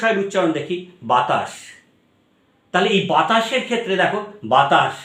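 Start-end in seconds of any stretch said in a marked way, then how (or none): 1.1-5.0 বাতাস, স hissed at the tooth ridge; বাতাস with a dental hiss. Shown as a demonstration. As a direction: tip of the tongue behind the teeth for the স in বাতাস. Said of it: "দন্তের স".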